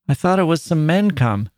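put-on a worried child explaining herself to her mother; plaintive child. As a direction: daughter telling her mother she heard men. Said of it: "the plaintive voice of the child".

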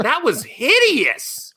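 very slow and high, dragging out hideous; disgusted drawl. Acted. Host mocks the cringe dialogue in drawn-out disgust. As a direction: stretch it out in mock disgust.

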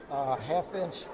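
ordinary talk

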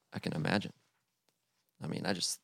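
ordinary delivery

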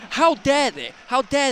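comic affronted exclamation; mock outrage. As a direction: protest as if personally insulted. Joke outrage over borrowed sweet name.

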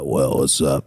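low, groggy just-woke-up voice. Retold or imitated. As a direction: sound half asleep and groggy. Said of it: "That groggy voice".

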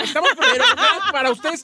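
laughing. Real, not acted. Co-host laughs while telling others to stop criticizing, laughing. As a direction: scold them while laughing.

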